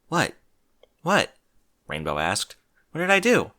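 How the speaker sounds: confused Rainbow Dash. Acted.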